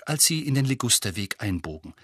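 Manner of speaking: usual speaking voice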